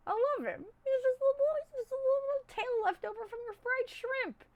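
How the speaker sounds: very high, cutesy squeal of adoration; cutesy gushing. Real, not acted. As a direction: gush over him in a tiny high voice. Gushing over a cute plush.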